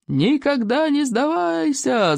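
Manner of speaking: high, drawn-out rallying cry; gleeful yelling. A narrator's reading. Pleased hooligans yell back the chief's motto, gleeful. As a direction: stretch it out high like a pleased mob. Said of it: "заорали довольные хулиганы".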